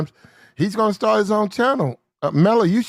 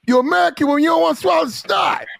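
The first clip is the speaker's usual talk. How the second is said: raised, higher ranting voice; shouting; 8 semitones higher than usual. Real, not acted. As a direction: call it out in a heated rant. Heated rant about American women.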